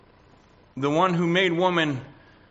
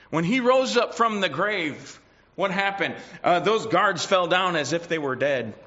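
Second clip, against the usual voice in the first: raised, ringing pulpit delivery; preaching at full force; 4 semitones higher than usual. Acted. Preacher exhorting the congregation, in full preaching voice. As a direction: proclaim it to the whole church.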